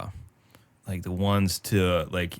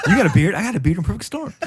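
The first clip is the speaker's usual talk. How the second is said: higher, louder, laughing through it; Mark Wahlberg impression; 11 semitones higher, 5 dB louder, faster than usual. Doing Samberg's Wahlberg for his friend, laughing. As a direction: brag about the beard like Wahlberg.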